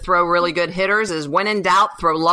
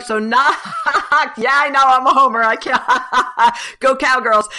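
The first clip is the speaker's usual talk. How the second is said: real laughter breaking into higher talk; laughing admission; 6 semitones higher, 4 dB louder than usual. Real, not acted. Analyst admitting she is a homer, laughing. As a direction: laugh through the confession. Laughing at own team bias.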